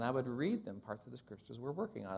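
normal talking voice

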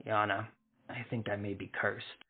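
quiet, choked-up confession. Acted. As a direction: hold back tears as you say it. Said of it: "said in a choked voice".

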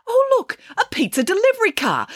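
high, excited exclamation. A narrator's reading. Nanny Piggins spots a pizza car, excited. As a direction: call it out with pitch leaping up.

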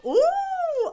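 light up at the idea; excited high ooh; delighted ooh. Real, not acted.

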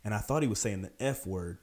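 ordinary talk, nothing marked

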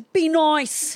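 mock British parent telling off; parents yelling. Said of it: "parents are yelling at me".

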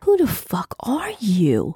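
slow, whispery, out of breath; breathless demand. A narrator's reading. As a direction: gasp the demand as if just after a marathon. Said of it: "finally demanded breathlessly".

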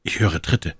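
usual speaking voice

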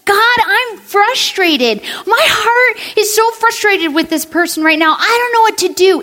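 high, strained cry to God; a desperate, honest plea to God; frustrated prayer. Pretend. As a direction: pour out the frustration, near tears. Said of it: "You can say".

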